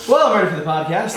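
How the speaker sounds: suddenly high and energized. Real, not acted. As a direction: pipe up, jolted awake by smelling salts. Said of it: "My voice is a lot louder now".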